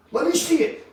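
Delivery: pushy friend who must look now; eager buddy. Retold or imitated. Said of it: "he's like".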